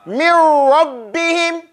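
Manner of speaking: slow drawn-out Mir Rabbihim with rolled r; Quranic recitation. Shown as a demonstration. Teacher reciting for the student, slow Arabic. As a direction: recite Mir Rabbihim slowly, noon merged into the ra. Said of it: "we have to jump straight into this mirror".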